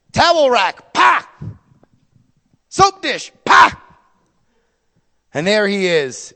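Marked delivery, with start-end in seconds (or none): 0.9-1.3 loud mouth crash pa; crash noise. Retold or imitated. Fixtures smashed. 3.4-3.8 loud mouth crash pa; crash noise. Retold or imitated. Fixtures smashed.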